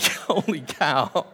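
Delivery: slow "holy cow" through laughter; laughing amazement. Retold or imitated. Pastor reacting to a friend's joke, slow and laughing. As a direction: let the laugh spill into it.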